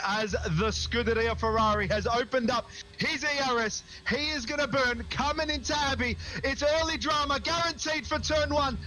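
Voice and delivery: epic, heartfelt lap call for a friend; cinematic race call. Acted. Dramatic tribute commentary for lost friend.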